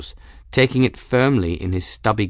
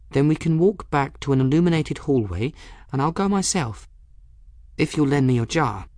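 put-on higher-pitched girl, reasoning firmly; earnest girl; 6 semitones higher than usual. Bridget persuading frightened Folly to go on, earnest.

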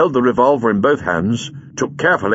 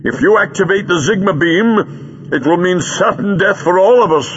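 high, louder, rising to emphatic; urgent warning; 9 semitones higher, 4 dB louder than usual. A narrator's reading. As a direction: warn of deadly danger. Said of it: "warned the Doctor".

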